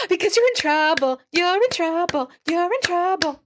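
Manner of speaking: high singsong taunt, slowing down; uncontainable gloating outburst. Acted. Esther unable to hold herself back, high taunting outburst. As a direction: blurt it out high, then chant it. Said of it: "Esther just can't hold, get a hold of herself".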